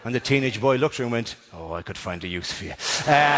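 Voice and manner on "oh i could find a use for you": low, soft, suggestive aside; sly innuendo. Acted. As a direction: murmur it low like a creepy suitor. Teenage boy's sly reply.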